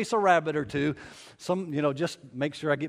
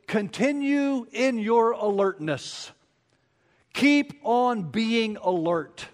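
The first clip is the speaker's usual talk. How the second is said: "continue in your alertness" slow and higher, every word weighted; emphatic command; 5 semitones higher, much slower than usual. Shown as a demonstration. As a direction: drag out "Keep on being alert" as a high, weighty command. Imperative voice is to speak with emphasis.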